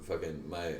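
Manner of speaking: normal talking voice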